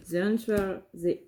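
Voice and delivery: slow, careful English with a French accent; joking French-accented English read aloud. Shown as a demonstration. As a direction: spell out the English slowly in a self-mocking French accent. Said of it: "Avec mon superbe accent anglais".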